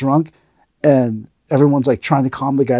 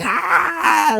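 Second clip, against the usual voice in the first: high-pitched mock martial arts yell; put-on cry of a master striking; imitated attack cry. Mister Miyagi attacking his student, imitated yell.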